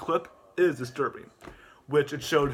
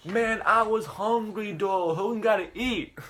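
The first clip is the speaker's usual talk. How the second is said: high, smug vlogger drawl; slow, cocky plug of the merch; smug YouTuber interview voice, higher; Hogan Paul voice; 7 semitones higher, slower than usual. Acted. Hogan Paul excuses himself.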